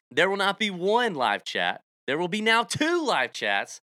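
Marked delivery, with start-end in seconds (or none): none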